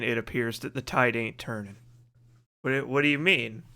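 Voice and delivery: usual speaking voice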